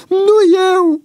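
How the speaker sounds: very high, loud tearful wail; bursting into tears. A narrator's reading. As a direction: wail a desperate denial. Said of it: "izbucni Boian în plâns". (izbucnire în plâns)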